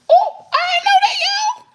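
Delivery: squealing high mock surprise at the gossip; mock shock. Real, not acted. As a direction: act floored by the news.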